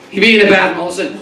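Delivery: ordinary talk, nothing marked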